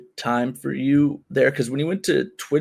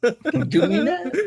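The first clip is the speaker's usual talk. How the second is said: high, slow, laughing neckbeard squeak; mock the incel in a high voice; 11 semitones higher, slower than usual. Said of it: "Is that your neckbeard voice?".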